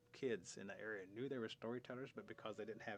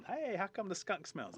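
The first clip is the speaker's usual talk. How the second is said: put-on high, loud, slow child's question; curious child; 10 semitones higher, 6 dB louder than usual. Child asking storytellers about skunk, curious. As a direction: pipe up like a curious kid. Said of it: "So child goes up".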